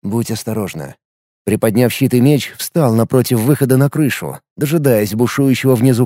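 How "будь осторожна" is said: soft, tender farewell. Acted. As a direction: say it gently, softly. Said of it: "нежно провел рукой".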